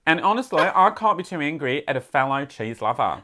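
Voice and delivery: letter read in a put-on Aussie accent; Australian accent. Podcaster reading a listener's letter on request, Australian accent. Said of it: "Please read in your best Australian accent".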